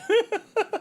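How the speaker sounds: short high-pitched giggle burst; high laugh. Real, not acted. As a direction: let out a squeaky laugh. Reacts to chat comment.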